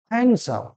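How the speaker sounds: হ্যান্ডসাম with silent d, "han-sam"; corrected handsome. Shown as a demonstration. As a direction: say হ্যান্ডসাম as "HAN-sum", dropping the d. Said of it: "কি হবে?". (হ্যান্ডসাম-এর সঠিক উচ্চারণ, ড নীরব)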